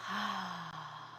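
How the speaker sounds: soft open mouth sigh; audible open mouth sigh. Shown as a demonstration. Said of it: "make it audible".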